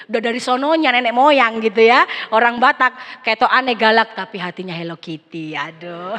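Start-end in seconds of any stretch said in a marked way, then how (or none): none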